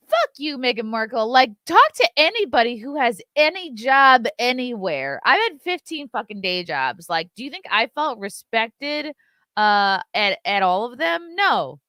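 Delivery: loud, high, indignant tirade; angry rant. Real, not acted. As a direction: rail at her, fed up. Angry rant at Meghan Markle.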